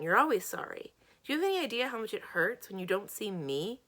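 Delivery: loud, wounded accusation; hurt, heated reproach; hurt anger. Acted. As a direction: throw the apology back angrily. Acted quarrel, hurt friend.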